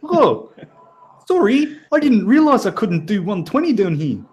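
put-on high, casual Kiwi cop-show bro accent; mock Kiwi accent. Driver sweet-talking a New Zealand cop, in a mock Kiwi accent. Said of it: "I think I'm losing the accent now".

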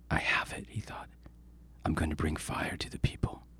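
hushed triumphant whisper. A narrator's reading. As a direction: whisper it like a stolen secret. Coyote's thought while stealing.

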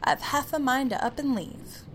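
high, loud indignant shout. Acted. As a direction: shout it in a huff. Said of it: "and then shouts".

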